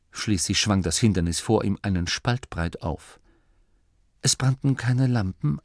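plain reading voice

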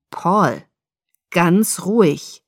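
slow, controlled order; measured command. A narrator's reading. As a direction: order him to calm down, slowly. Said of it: "befahl Sam".

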